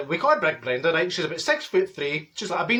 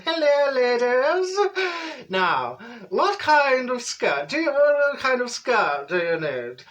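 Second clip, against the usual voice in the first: slow, higher, put-on shop assistant voice; posh shop lady; 6 semitones higher, much slower than usual. Comic storyteller voicing Barbara greeting the ladies, high and slow. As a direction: drawl it out like a refined saleswoman.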